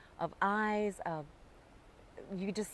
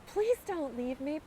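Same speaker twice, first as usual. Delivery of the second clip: pleading; 7 semitones higher than usual. Retold or imitated. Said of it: "this voice come out of me saying".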